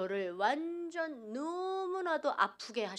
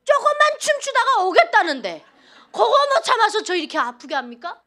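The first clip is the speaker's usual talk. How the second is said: loud, high, indignant protest at God; retold angry outburst; 10 semitones higher, 13 dB louder than usual. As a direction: shout it up at God, half laughing in disbelief. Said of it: "하나님께 막 해악질을 했어요".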